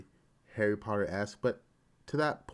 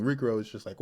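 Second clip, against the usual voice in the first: normal talking voice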